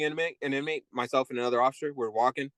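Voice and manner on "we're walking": ordinary talk, nothing marked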